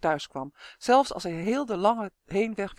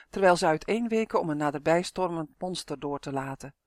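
plain reading voice

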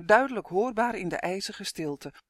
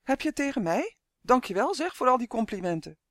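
higher-pitched, indignant comeback; sarcastic thanks in a higher voice; mock offended; 6 semitones higher than usual. A narrator's reading. Elf answers the captive's insults, mock offended.

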